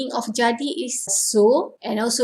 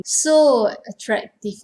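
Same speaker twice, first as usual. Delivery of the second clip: ordinary talk, nothing marked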